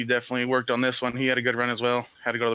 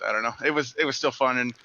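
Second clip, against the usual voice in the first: ordinary delivery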